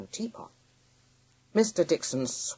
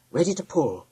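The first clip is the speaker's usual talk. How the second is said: plain reading voice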